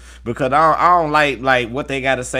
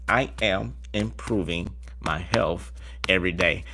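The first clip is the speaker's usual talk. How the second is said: slower, softer and lower repeat; slow soft affirmation; 5 semitones lower, 6 dB quieter than usual. Shown as a demonstration. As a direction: savour each word calmly. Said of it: "affirmation repeated slow soft".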